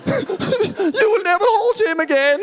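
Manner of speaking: very high, drawn-out comic wail; mock uncontrollable weeping. Wife sobbing at husband who threw co-sleeper, mock uncontrollable weeping. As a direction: wail it slowly, hammed-up and way up high. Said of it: "is uncontrollably weeping".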